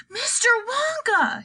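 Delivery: high, slow calling yell; drawn-out high-pitched hail; yelled above noise. A narrator's reading. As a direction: call the name high over a din. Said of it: "he yelled above the noise".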